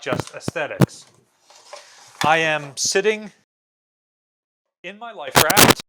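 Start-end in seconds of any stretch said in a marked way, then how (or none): 2.2-5.8 playful announcement. Pretend. Sitting down to demonstrate.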